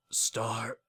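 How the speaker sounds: soft, fading murmur; faint dying voice. Acted. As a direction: let the words die away. Dying king hesitates.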